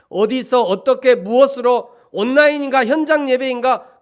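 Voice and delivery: fervent pulpit exhortation; full-force preaching. Acted. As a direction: press the question on the congregation.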